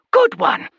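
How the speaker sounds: small, very high, urgent voice; squeaky high advice; tiny Calvin voice. Acted. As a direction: pipe up like a tiny pocket creature. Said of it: "Calvin encouraged".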